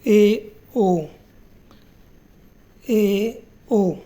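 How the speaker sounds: ए ओ drawn out slowly and stated twice; vowel demo. Shown as a demonstration. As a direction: model ए and ओ deliberately. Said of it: "जैसे".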